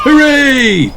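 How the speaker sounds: high, loud hooray; cheering. Acted. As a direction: cheer in triumph. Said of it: "cheer all the daddies".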